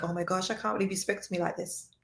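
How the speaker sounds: soft, fast, hurt murmur under her breath; barely voiced, as if stunned; hushed disbelief. Woman recalls a partner's harsh words, murmuring in hushed disbelief.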